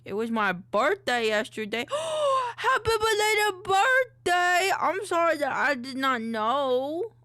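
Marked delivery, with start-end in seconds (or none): 1.9-2.5 sharp high excited gasp. Real, not acted. Hears chat birthday news. 2.6-7.1 loud, high, excited wishes; excited birthday wish. Real, not acted.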